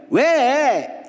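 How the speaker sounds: put-on high-pitched kid's "what" yelled back; yelled reply. Boy finally answering his mother's call, yelled reply. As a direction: imitate a boy calling back, high and grudging.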